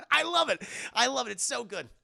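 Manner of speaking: ordinary talk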